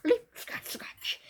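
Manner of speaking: a tiny nit springing high; squeaky nit voice. Acted. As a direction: leap squeaked very high, scratch whispered. Voicing the nits' leaping.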